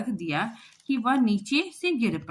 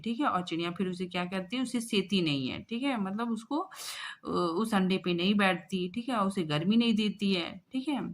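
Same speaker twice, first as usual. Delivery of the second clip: ordinary talk, nothing marked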